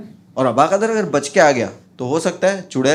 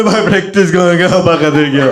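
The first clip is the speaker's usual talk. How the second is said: crying; 2 semitones higher, 7 dB louder than usual. Retold or imitated. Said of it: "वो रोते रोते बोल रहा है".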